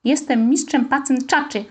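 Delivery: ordinary delivery, nothing marked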